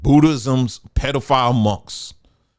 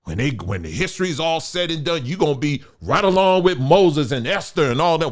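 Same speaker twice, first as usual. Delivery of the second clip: ordinary talk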